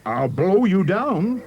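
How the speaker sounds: wolf threat. A narrator's reading. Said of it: "the wolf replied".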